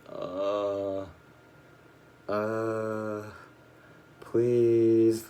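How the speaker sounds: long drawn-out groans, swelling into a loud plea; groaning. Retold or imitated. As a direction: groan miserably, imitating yourself sick in bed. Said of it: "I'm, like, groaning".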